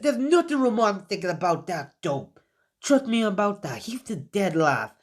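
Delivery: high, dismissive sneer; scornful Sakura. Acted. Sakura scoffing at her sensei about Naruto, high and dismissive.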